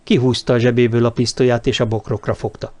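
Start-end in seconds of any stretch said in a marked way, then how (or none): none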